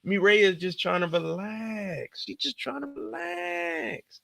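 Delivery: high, slow whiny plea; mock pleading. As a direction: beg Kendall to stop in a high whine. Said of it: "I'm like".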